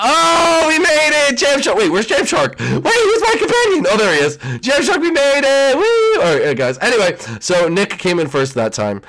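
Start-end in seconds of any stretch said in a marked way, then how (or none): 0.0-6.2 high, loud victory cheer; excited cheering. Real, not acted. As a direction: whoop at making it. Reaches finish line.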